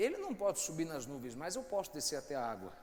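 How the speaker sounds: quiet, reflective recital; soft poetic opening. Acted. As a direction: speak it low like a parable. Pastor opens with soft poetic recitation. (recitação suave e reflexiva)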